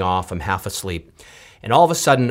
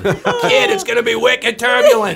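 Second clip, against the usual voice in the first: higher, laughing surfer dude; surfer-dude pilot; 3 dB louder than usual. Retold or imitated. Host imagines a pilot announcing like a surfer dude, higher and laughing. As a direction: play a slacker pilot on the intercom. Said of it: "imagine if the pilot got on there".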